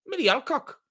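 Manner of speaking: Millie Alcock in a bad Australian accent, high; badly faked Australian accent; failed Australian accent. Shown as a demonstration. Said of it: "I probably need an accent to say it".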